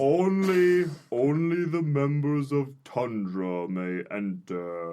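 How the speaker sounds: stern gatekeeper intoning a rule; solemn door guardian. Acted. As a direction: speak as a grave doorkeeper.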